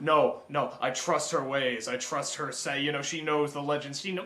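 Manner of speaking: mock-serious low drone; deep mock voice. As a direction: drop way down like a grave believer. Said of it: "he's just like".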